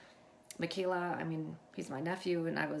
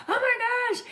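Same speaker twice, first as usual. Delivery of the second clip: imitating a high, loud, thrilled outburst; excited disbelief; 12 dB louder than usual. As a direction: cry it out high, as the amazed niece. Niece's retold reaction to being picked.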